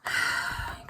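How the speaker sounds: long, weary exhale; mock-despairing sigh; exasperated sigh. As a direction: breathe out hard at your own foolishness. Exasperated at herself.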